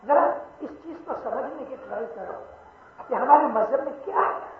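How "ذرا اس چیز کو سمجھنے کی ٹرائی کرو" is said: normal talking voice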